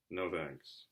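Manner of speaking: quiet, dry no thanks; dry refusal. Acted. Harry turning down Dudley, quiet and dry. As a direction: brush him off quietly.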